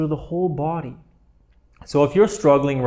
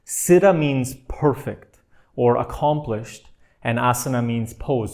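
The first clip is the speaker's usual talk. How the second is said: slow explanation; slower than usual. Shown as a demonstration. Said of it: "teaching Sanskrit terms".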